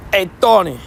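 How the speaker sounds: lightly hammed-up New York greeting; attempted New York accent. Shown as a demonstration. Streamer trying out New York lines on chat, amateur New York accent. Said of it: "try to do a New York accent".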